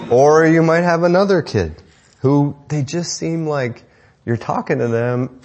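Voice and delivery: normal talking voice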